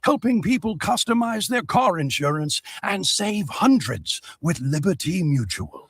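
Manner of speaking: hushed wildlife-film narration; nature documentary. Acted. Narrator observing emu through binoculars.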